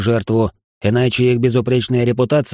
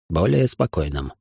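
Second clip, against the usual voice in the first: plain reading voice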